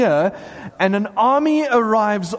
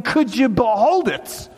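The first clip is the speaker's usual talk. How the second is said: drawn-out plea rising to a high cry; plead, then exclaim in amazement; impassioned plea; 5 semitones higher than usual. Acted. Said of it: "and John is saying".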